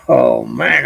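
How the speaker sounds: ordinary delivery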